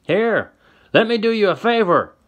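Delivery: very high, loud menacing yell; old man yelling. Acted. Old man turns his rifle on Tessa, yelling high. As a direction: sneer it out at the top of his voice. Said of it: "the old man yells".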